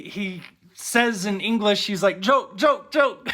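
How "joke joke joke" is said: higher, louder, insistent repeats; imitated barber. Barber telling the shocked customer it's a prank, imitated insistence. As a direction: imitate someone urgently explaining themselves.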